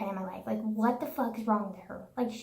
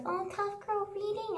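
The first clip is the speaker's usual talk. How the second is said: mocking kid; 9 semitones higher, 6 dB quieter than usual. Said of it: "he was like".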